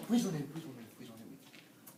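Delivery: fading to a tiny, low murmur; cowering plea. Acted. Teacher begs not to be hit, cowering and fading.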